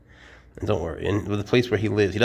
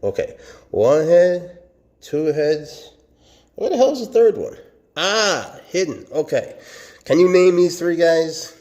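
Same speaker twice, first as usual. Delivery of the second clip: louder, higher, hunting for the third head; excited searching; 7 semitones higher, 7 dB louder than usual. Real, not acted. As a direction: exclaim while searching. Excited counting heads, searching.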